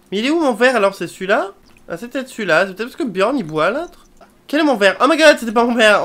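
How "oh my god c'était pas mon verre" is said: louder startled oh my god; embarrassed surprise. Real, not acted. Player realizes she took someone's glass. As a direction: catch yourself in a blunder.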